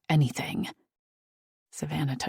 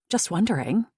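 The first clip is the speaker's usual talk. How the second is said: usual speaking voice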